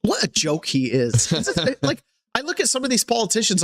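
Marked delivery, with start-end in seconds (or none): none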